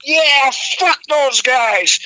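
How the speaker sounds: high, slow crowd cheer; drawn out like a happy fan. Retold or imitated.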